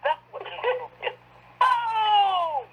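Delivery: exultant cry of Boom, way up high; excited shout. Real, not acted. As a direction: throw it out like a mic drop. Hyped exclamation about the book.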